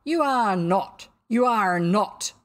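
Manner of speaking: loud, slow, forceful denial; hoarse cry. A narrator's reading. Captain disputes the impostor, crying out.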